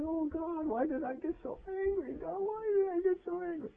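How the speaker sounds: high, hushed, tearful plea; preacher retelling how he cried to God in the car; crying prayer. Retold or imitated. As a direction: sob the prayer out high and soft. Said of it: "I was crying like a baby".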